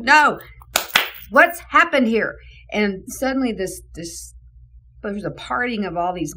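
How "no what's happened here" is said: loud, high, pressing call over the crowd; urgent shout. Retold or imitated. Director pushing through a crying lobby crowd, loud urgent call. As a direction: demand to know what happened, raising your voice.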